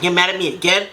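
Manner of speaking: ordinary talk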